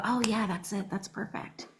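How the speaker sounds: fading to a hushed approval; understated director approval; quiet approval. Retold or imitated.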